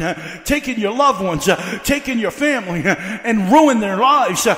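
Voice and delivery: rhythmic revival preaching rising to a peak; full-force preaching. Real, not acted. Preacher urging the oppressed to stand up, full-force preaching. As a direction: drive the appeal home in punched, gasped phrases.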